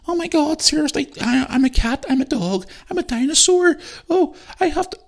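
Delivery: very high falsetto kid impression; mocking schoolkids. Imagined friend laughing at schoolkids' identities, mocking falsetto. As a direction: squeal it in an absurdly high, mocking voice. Mimicking kids going into school.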